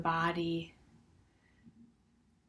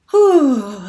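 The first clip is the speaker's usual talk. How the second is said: loud, high "whew", falling away; releasing exhale; 6 semitones higher, 14 dB louder than usual. Real, not acted. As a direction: blow out the effort after shaking. Exhales after shaking it out.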